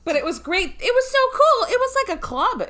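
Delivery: high, loud gushing; excited. Real, not acted. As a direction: gush about how cool it was. Gushing about the night.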